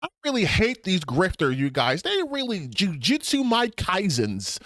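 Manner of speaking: high, exaggerated fake YouTuber rant; put-on annoying voice. Streamer mocks ranting about grifters, high put-on voice. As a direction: whine it out in a squeaky fake voice. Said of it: "if I was just like".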